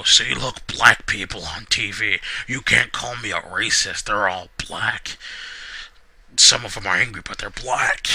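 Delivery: mock Vince McMahon, loud and gruff. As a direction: lampoon the chairman defending himself. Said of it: "this Vince voice hurts my throat".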